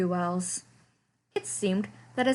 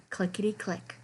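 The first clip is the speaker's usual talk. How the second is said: ordinary delivery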